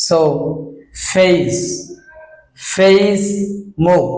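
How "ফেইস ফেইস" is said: ফেস with an ei glide, "feis"; careful model ফেস, e sliding to i; correct pronunciation. Shown as a demonstration. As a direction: say ফেস as feis, vowel drawn out. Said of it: "এটা তো অবশ্যই ফেস হবে".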